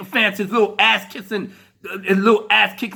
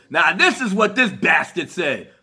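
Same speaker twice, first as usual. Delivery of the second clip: ordinary talk, nothing marked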